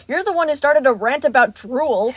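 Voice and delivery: loud, indignant retort; snapped accusation. A narrator's reading. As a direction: fire the blame back angrily. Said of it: "Roxy snapped".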